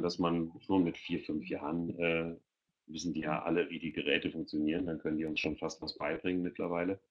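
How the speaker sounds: normal talking voice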